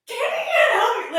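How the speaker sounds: high loud wail through laughter; hammed-up sobbing protest; laughing mock wail. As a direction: cry it out comically.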